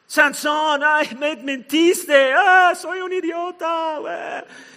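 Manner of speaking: high, loud, drawn-out mock wail; hammed-up tearful complaint; wailing woman. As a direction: whine like a betrayed girlfriend. Acting wronged woman complaining to Samson.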